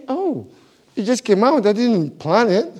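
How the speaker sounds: usual speaking voice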